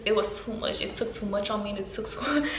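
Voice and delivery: ordinary delivery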